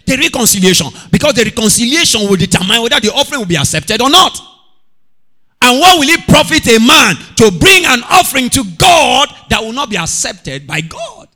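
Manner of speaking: high, loud preaching build; preaching climax. Real, not acted. Preacher warning the church about offerings, shouting. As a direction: drive it up to a shout.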